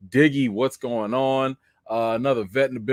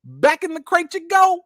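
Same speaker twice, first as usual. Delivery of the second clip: much higher, exasperated order; dog owner ordering the pup back into its crate; exasperated scolding; 5 dB louder than usual. Real, not acted. Scolding the dog after peeing.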